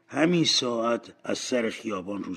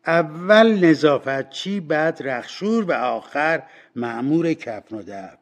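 high, loud announcement from the first woman; 6 semitones higher, 9 dB louder than usual. A narrator's reading. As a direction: call it out loudly to claim first turn. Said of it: "با صدای بلند گفت".

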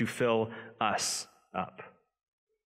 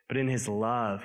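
ordinary talk

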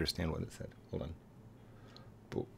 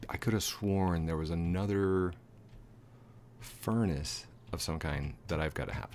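ordinary talk

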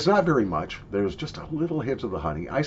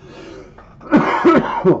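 harsh cough; 10 dB louder than usual. Real, not acted. Coughing on snuff dust.

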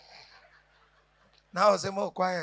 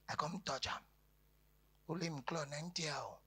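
usual speaking voice

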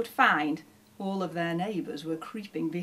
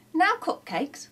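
excited cry; 9 semitones higher than usual. Acted. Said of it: "cried Shifty".

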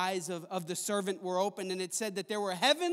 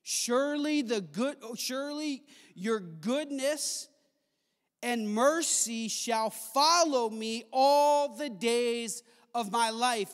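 preached verse; 3 semitones higher, 7 dB louder, slower than usual. Acted. Re-reads the verse with full force.

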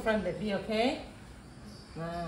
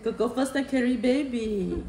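ordinary talk, nothing marked

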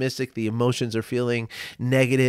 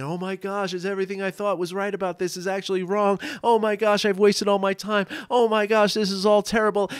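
higher, hammed-up spiral of worry; a half-mock voice of someone sure they have failed; mock panicked inner voice; 6 semitones higher than usual. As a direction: play the anxious mind talking itself into doom. Voicing a catastrophizing inner monologue.

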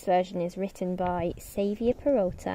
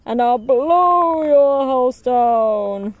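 slow, louder growing roar; roaring wolf; 2 semitones higher, 7 dB louder, slower than usual. Acted. Wolf threatening the first pig, roaring louder and slower. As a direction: build to a roar on blow. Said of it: "roared the wolf".

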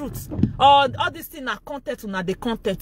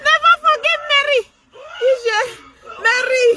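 laughing shout; 8 semitones higher than usual. Real, not acted. Cheering Mary on with a laughing, high shout. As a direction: yell it while cracking up.

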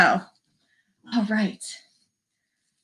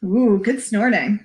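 usual speaking voice

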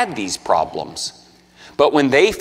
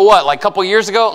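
mock skeptic; 8 semitones higher, 4 dB louder, faster than usual. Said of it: "you go".

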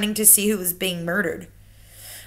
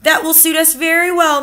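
higher, louder grandmother voice; accusing grandmother; 8 semitones higher, 7 dB louder than usual. Acted. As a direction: pin the blame on him. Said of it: "my grandmother said".

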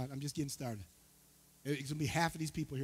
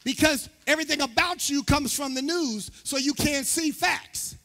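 raised, forceful pulpit voice; preaching; 8 semitones higher, 10 dB louder than usual. Acted. Pastor tells the church the bigger problem, preaching. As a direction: drive the warning home to the congregation.

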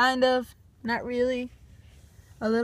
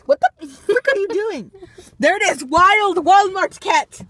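excited; 7 semitones higher, 7 dB louder than usual. Pretend. Excited as cat reappears.

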